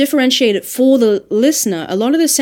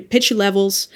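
ordinary delivery

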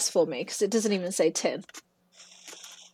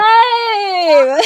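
a high, loud cry of surprise that breaks into laughter; yelp, then laugh it off; laughing shout; 11 semitones higher, 9 dB louder than usual. Real, not acted. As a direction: caught out and cracking up.

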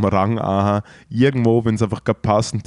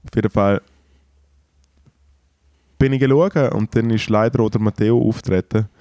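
usual speaking voice

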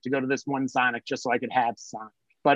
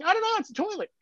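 playing dumb; 3 dB quieter, faster than usual.

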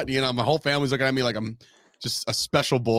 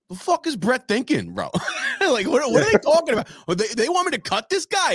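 high, loud mocking stepmom rant; bonus mom Barry; 11 semitones higher, 3 dB louder than usual. Retelling his bonus mom's angry reaction, high mock rant. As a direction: squeak out the outraged stepmom. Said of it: "she'll be like".

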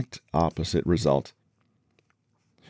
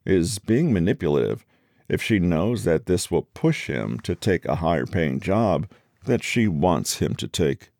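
usual speaking voice

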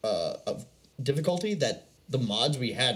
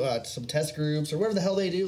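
normal talking voice

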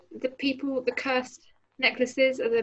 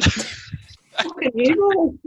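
mock echo; 5 semitones higher, 6 dB louder, much slower than usual. Echoes the curse word mockingly.

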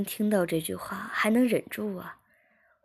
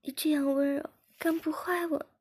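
high-pitched character voice broken by moans; moaning while speaking; 9 semitones higher than usual. A narrator's reading. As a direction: speak much higher, moaning between phrases. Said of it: "边呻吟边说". (边呻吟边说，声音很高)

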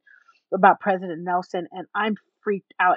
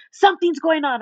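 loud, raised, insistent mimicry; imitating a worked-up stranger; urgent high energy; 6 semitones higher, 5 dB louder than usual. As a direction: press it urgently, higher and louder. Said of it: "this is the energy".

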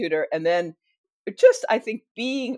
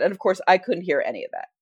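normal talking voice

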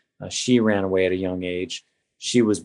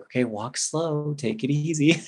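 nurse's caution; 6 semitones higher than usual. Retold or imitated. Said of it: "she's like".

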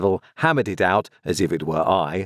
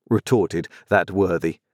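plain reading voice

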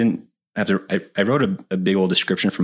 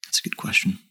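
whispered; 5 dB quieter than usual. Real, not acted. Mock-secretive aside about Clamp.

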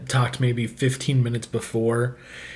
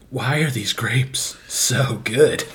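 whispering; slower than usual. Retold or imitated. Marveling at the grapes.

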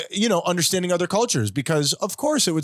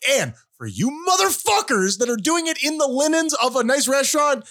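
lightly voiced crier decree, raised high; town crier; 6 semitones higher than usual. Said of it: "Can I do a hear ye".

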